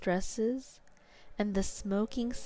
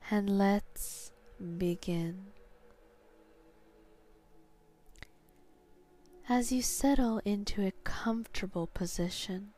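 sleep story narrator; slower than usual. Acted. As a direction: guide them down into sleep, slowly. Guided hypnosis induction.

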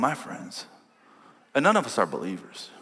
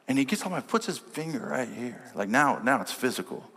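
ordinary talk, nothing marked

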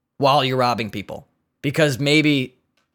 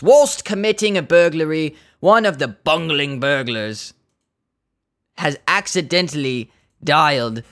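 lightly voiced mock British inspector; British accent; 3 semitones higher than usual. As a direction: read the tweet as a British officer would. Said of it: "This is C I John Owen".

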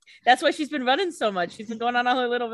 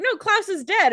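insistent Elena; 11 semitones higher, 4 dB louder than usual. Retold or imitated.

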